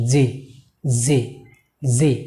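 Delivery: জি with a pressed, buzzing initial consonant; corrected pronunciation. Shown as a demonstration. Teacher modelling the letter for learners, careful corrected pronunciation. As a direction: lean on the first sound of জি. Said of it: "আমরা এভাবে উচ্চারণ করব".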